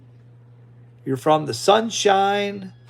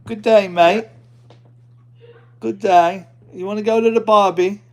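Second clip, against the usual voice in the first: hammed-up Aussie greeting, louder; playful fake Australian accent, higher; mock Australian; 5 semitones higher, 6 dB louder than usual. Greeting a possible Aussie newcomer in mock Australian accent. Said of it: "Is Briley an Aussie?".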